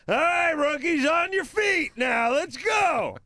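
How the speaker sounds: high, loud barked orders; drill sergeant. Retold or imitated. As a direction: shout orders at the rookies. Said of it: "like a drill sergeant".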